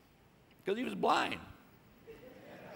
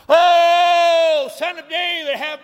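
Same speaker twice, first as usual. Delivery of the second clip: very high, very loud wail; loudest cry; 10 semitones higher, 14 dB louder, much slower than usual. Retold or imitated. Blind beggar's loudest cry to Jesus, full-throated wail. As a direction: cry out at the top of your lungs. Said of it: "it gets louder and louder".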